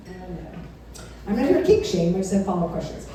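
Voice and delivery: normal talking voice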